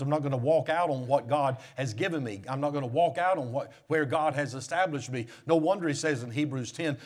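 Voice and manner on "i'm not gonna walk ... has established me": firm resolve. Acted. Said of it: "resolve, repeated".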